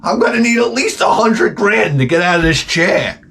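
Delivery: high, fast hammed-up yelling; hammed-up shout. Comic acts out the exam scene in a high mock shout. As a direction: scream it like an outraged cartoon. Doctor and victim acted out.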